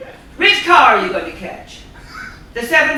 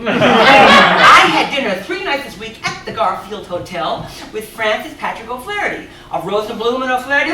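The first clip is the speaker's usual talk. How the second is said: ordinary delivery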